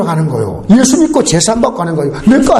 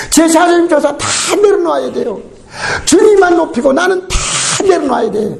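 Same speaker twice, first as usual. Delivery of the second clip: impassioned shout; 8 semitones higher than usual. Real, not acted. Preacher urging surrender of pride.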